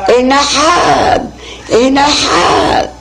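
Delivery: high, long-drawn call. A narrator's reading. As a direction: stretch each syllable out like calling down from a rooftop. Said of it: "empezó a gritar". (grito alargado llamando)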